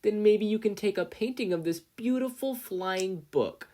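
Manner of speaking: high, loud syrupy taunt; sickly sweet. A narrator's reading. Bully mocking Izuku's art in a sickly sweet taunt. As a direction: coo it with fake sweetness.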